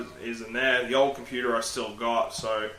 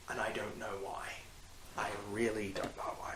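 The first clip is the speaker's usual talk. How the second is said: hushed, much softer; whispered confession; 4 semitones lower, 13 dB quieter than usual. Real, not acted. Vlogger admitting he stares at himself, whispered. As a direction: drop to a whisper, bemused.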